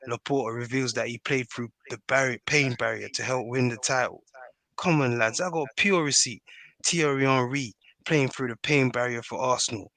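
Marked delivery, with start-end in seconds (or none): none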